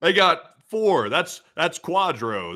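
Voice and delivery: slow, loud and high; excited. Real, not acted. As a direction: savor the news. Excited at the loss count.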